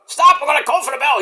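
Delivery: high, frantic mock referee yelling stop; imitating referee. Referee telling the wrestler to stop, high and frantic. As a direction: play the panicked ref. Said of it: "The referee's like".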